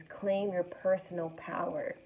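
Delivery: usual speaking voice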